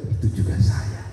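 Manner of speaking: whispered, broken-off admission; whispered confession. Acted. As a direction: breathe it out as a whisper. Weeping man's confession. (bisikan pengakuan)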